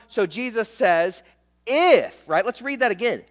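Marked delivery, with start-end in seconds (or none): 1.7-2.3 "If" punched high and loud, then left hanging; single emphatic "If"; stress on IF. Shown as a demonstration. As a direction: hit "If" hard as a word on its own. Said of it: "the word if".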